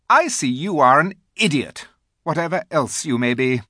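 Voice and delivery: high, loud contempt; scornful queen. A narrator's reading. The Queen calls Edmund an idiot, scornful. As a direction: dismiss him haughtily. Queen insults confused boy.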